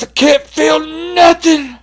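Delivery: high, loud, slow wailing complaint; high panicked cry. Retold or imitated. Drunk telling friends he's numb, high wailing cry. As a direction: cry it like you're scared of dying. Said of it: "He goes".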